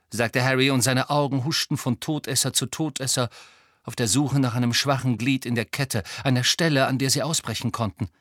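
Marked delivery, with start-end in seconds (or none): none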